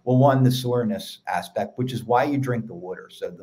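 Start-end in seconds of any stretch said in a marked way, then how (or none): 2.8-3.1 water said as "wʊtə˞", Philly style; Philly accent. Real, not acted. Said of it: "hear my Philly accent with the water".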